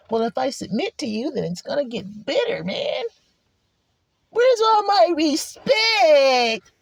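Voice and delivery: mock wail rising to a high, drawn-out shout; whining protest. Acted protest at submitting.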